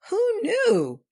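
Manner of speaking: high squeaky who knew; surprised. Real, not acted. Mock amazement at dog ENTs.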